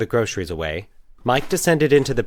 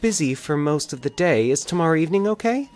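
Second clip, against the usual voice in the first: hopeful; 10 semitones higher than usual. A narrator's reading. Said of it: "Valerie asked hopefully".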